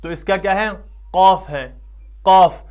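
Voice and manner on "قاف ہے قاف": thick قاف from the tongue root; distinct thick pronunciation. Shown as a demonstration. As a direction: model a heavy, emphatic قاف. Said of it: "لیکن اس کا تلفظ الگ ہے".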